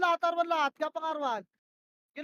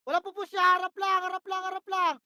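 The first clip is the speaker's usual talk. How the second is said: ordinary talk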